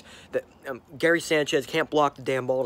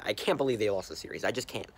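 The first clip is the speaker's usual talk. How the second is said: ordinary talk, nothing marked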